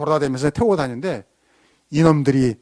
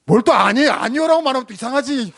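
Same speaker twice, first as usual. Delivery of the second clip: high, raised-voice mock complaint; playfully indignant and higher; playful protest; 7 semitones higher, 3 dB louder than usual. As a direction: tease with a pretend protest. Mock scolding the congregation's answer.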